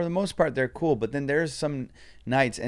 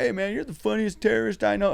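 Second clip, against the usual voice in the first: mock audience guy; 8 semitones higher than usual. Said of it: "be like".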